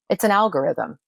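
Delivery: ordinary delivery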